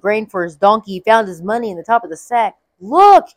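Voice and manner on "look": sudden high, loud cry of discovery; exclaiming. Acted. Brother finds money in his grain sack, loud surprised exclaim. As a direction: blurt it out, startled and amazed. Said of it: "he exclaimed to his brothers".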